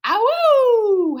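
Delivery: very high, loud howl; dog howling back at the police car. Acted. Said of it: "howled Stanley".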